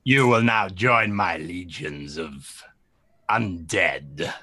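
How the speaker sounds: very low, soft, slow menace; deep, hushed otherworldly villain; voice of oblivion. Acted. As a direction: speak as a dark god claiming a soul. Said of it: "and you hear a voice".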